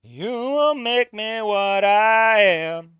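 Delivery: slow, loud, hammed-up heartfelt speech; mock dramatic reading. Streamer reading the game's father lines, hammed-up and slow. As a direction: drag each word out like a melodramatic hero.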